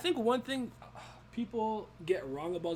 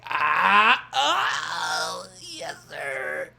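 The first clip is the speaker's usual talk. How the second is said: mock overwhelmed yes; 4 semitones higher, 9 dB louder, much slower than usual. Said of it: "And I'm like".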